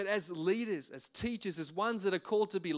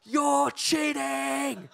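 loud, high, drawn-out heckle at the ref; imitated sideline yell; 7 semitones higher, 9 dB louder, much slower than usual. Marshal heckling the referee from the sideline, imitated yell. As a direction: yell it slowly across the pitch, mimicking an irate parent. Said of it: "is yelling out".

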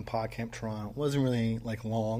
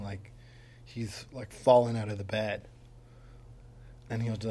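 normal talking voice

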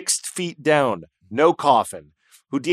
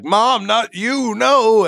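hammed-up grieving son, high and slow; mock anguished cry at the grave, higher; mock wail; 9 semitones higher, 4 dB louder, much slower than usual. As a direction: wail it like a melodramatic mourner. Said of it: "you know that classic".

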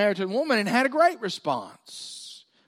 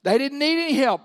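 normal talking voice

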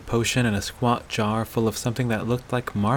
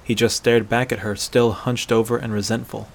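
plain reading voice

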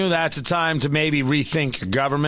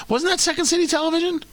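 high fast other voice; 10 semitones higher, much faster than usual. Pretend. Acting a voice interrupting.

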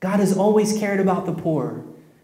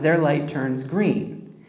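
normal talking voice

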